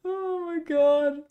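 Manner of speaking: high, drawn-out oh my God; exasperated disbelief. Real, not acted. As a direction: stretch it out in amused disbelief. Laughing disbelief at the comment.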